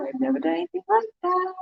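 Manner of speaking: quick, high excited rush. Real, not acted. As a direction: gush it out fast and high. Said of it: "kind of excited about doing it".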